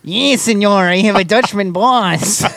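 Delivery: high, hammed-up cartoon Mexican accent; mock Mexican accent, stretched at the end; Speedy Gonzalez. Answering about a Dutchman boss in a cartoon Mexican accent. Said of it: "It's not racist to do Speedy Gonzalez".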